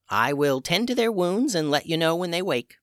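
put-on high, calm old witch; a witch healer in charge. A narrator's reading. Old witch Tubes speaks.

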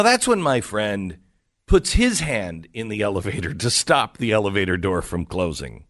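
ordinary talk

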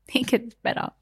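ordinary delivery

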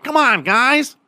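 very high, hammed-up little-guy voice; puny kicker's pep talk. Small kicker rallying huge teammates, mock high-voiced pep talk. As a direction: plead with the team in a comically high voice.

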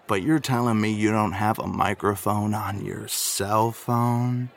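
usual speaking voice

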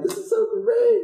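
high, gushing over-the-top joy; sarcastically thrilled about the rat droppings; mock delight. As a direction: squeal it like this is wonderful. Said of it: "I was so happy".

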